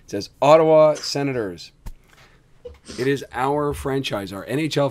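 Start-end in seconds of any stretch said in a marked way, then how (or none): 0.4-1.7 proud team name. Shown as a demonstration. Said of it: "you're gonna miss this demonstration".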